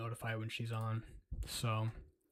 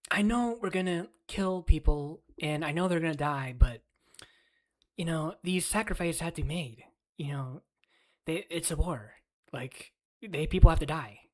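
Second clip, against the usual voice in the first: mock Ben Shapiro; 2 semitones higher, 3 dB louder than usual. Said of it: "That's my Ben Shapiro voice".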